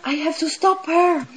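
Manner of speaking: high, urgent, half-acted alarm; panicked. Imagined panicked self, hurried and high. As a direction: press it out in a mock panic. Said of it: "I would get really in panic".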